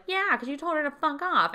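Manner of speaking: high-pitched mock outrage; indignant accusation. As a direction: imitate an affronted friend accusing him. Friend accusing the chef.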